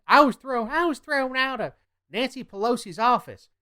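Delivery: high, loud indignant whine; mock outrage. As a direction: imitate someone making a big scene of being wronged. Mocking a politician's outraged complaint.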